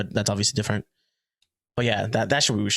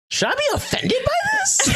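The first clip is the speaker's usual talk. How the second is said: very high-pitched mock question; mock offence. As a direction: squeal it as an exaggerated inner reaction. Acts out own reaction to accents.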